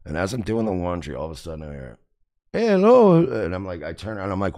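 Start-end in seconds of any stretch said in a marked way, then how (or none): 2.5-3.2 high, loud hail from a stranger; imitated high-pitched hello; calling out. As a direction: call out to get someone's attention.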